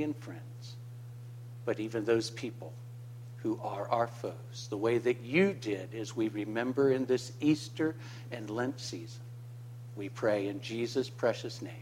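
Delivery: soft, low, reverent prayer. Real, not acted. Pastor closing prayer for the congregation, soft and reverent. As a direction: pray quietly for the flock.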